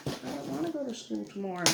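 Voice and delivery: kid's mild whine, retold; retold child's protest. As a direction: lightly voice a child not wanting school. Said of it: "She doesn't wanna go to school".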